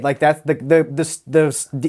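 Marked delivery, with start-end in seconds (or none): none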